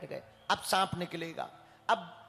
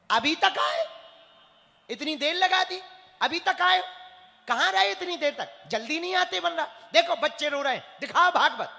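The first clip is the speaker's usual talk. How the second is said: loud, higher impatient yelling at the latecomer; 7 semitones higher, 5 dB louder than usual. Retold or imitated. Headman yelling at the late Kashi pandit, loud and impatient. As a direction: bark at him for being so late. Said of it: "वो हमसे ही चिल्ला के कह रहा है".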